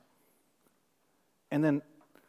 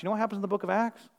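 ordinary talk, nothing marked

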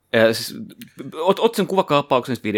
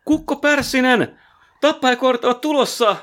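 mock warning shout; 12 semitones higher than usual. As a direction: cry out a hammed-up warning. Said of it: "ja huudetaan".

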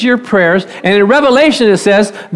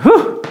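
excited whoop; 8 semitones higher than usual. Retold or imitated. Excited man in church.

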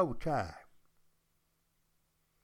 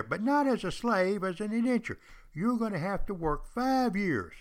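recruiter's smooth pitch; 4 semitones higher than usual. Pretend. Recruiter persuading a poor man to sign on, high eager salesmanship. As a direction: sell the deal with big promises. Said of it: "what could sound better than this?".

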